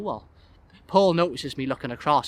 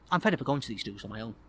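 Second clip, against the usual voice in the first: ordinary delivery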